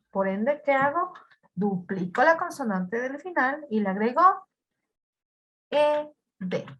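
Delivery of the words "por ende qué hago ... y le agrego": ordinary talk, nothing marked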